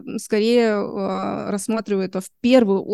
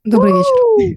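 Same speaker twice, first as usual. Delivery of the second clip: cartoon princess; 6 dB louder, slower than usual. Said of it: "волшебный магический голос принцессы Вишенки".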